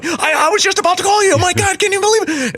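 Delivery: high, fast breathless voice; excited cousin. Retold or imitated. Cousin on the phone about the arrest, high fast excited. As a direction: gush it out in a rush. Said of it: "she's going".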